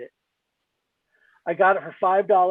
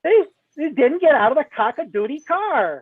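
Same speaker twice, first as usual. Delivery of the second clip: high, indignant Annie Wilkes rant; 9 semitones higher, 4 dB louder than usual. Retold or imitated. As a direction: channel an outraged prim fan. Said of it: "reminded me of Annie Wilkes in Misery".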